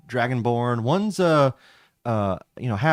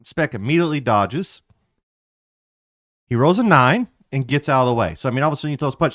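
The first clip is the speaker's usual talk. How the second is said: ordinary talk, nothing marked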